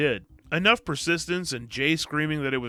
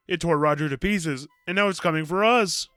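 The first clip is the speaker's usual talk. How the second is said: put-on louder, urgent retelling of a terrified boy; mock terrified; 5 semitones higher, 6 dB louder than usual. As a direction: play up the panic a little. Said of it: "who approaches him looking terrified".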